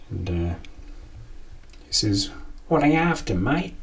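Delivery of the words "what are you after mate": put-on 1 Aussie accent. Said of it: "That's how they say it in Australia".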